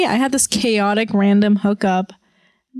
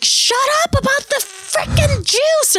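much higher, exasperated mock outburst; mock snapping at parent. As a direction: play it like a fed-up kid yelling at a parent.

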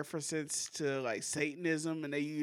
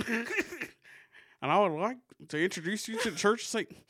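higher-pitched presidential impression, halting then hurried; Barack Obama; 5 semitones higher, 5 dB louder, faster than usual. President introducing Dennis to a church, playful impression. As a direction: imitate a president making a formal introduction. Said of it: "That was a pretty good Obama impression".

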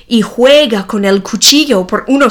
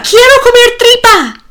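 much higher, louder shrieked demand; the tantrum peaks in a shriek; boy's demand peaking in a high shriek; 14 semitones higher, 6 dB louder than usual. A narrator's reading. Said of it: "el hijo grita".

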